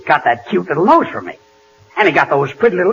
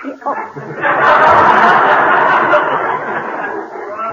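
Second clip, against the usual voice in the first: breathy laugh; 10 semitones higher than usual. Real, not acted. Laughs at the insult.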